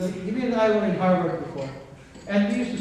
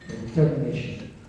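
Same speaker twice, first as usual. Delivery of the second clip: emphatic, measured oratory with long pauses; solemn podium recitation; recited quote; 4 dB louder than usual. Acted. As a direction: declaim it line by line, punching the key words. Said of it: "And it goes this way".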